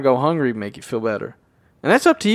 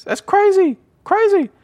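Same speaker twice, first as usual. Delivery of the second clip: high squeaky disbelief, much higher; amazed. Real, not acted. Podcaster amazed [name] turned down Neo, squeaking high. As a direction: shriek it in disbelief. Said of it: "marvels at turning down [name]".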